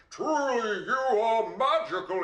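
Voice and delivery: high, hammed-up excited king; king's voice. Excited king praising the girl's gold, in a high put-on voice. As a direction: proclaim it as an amazed, excited king, high-pitched. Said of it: "That's his voice".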